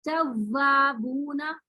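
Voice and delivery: जवाबूना slowly, the व pressed into a buzz, rising at the end; wrong buzzing reading. Shown as a demonstration. Teacher repeating the wrong pressed जवाबूना as a warning. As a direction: squeeze the व in जवाबूना and let it buzz. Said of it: "ये झंझनाहट wrong है".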